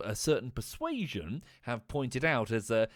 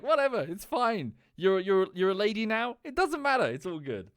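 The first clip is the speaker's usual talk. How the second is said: high-pitched, breezy dismissal; mock-casual acceptance, pitched up; lightly acted nonchalance; 7 semitones higher, 4 dB louder than usual. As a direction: wave it off as an easygoing Klingon. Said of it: "Klingons are like".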